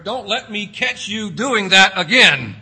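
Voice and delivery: loud stern parent threat; mock parent warning. Parent warning a child caught misbehaving, loud mock threat. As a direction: bark it like a fed-up parent.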